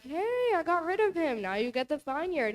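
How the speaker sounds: gleeful queen. Retold or imitated. Teacher voicing Queen Jezebel reporting to Ahab, high and gleeful. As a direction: chirp it like a proud schemer. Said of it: "she's like".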